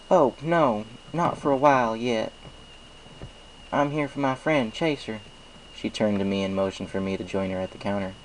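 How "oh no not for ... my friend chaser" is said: higher, louder folksy farm mare greeting; cheerful country pony saying hello; Applejack's voice. A narrator's reading. Applejack greets the baker at the counter, cheerful. Applejack introduces her friend.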